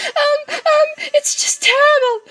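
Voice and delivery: very high-pitched, loud panicky squeal; frantic falsetto plea for help; panicked caller. Acted. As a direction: squeak in hammed-up distress, nearly wailing. Panicked caller voiced in game.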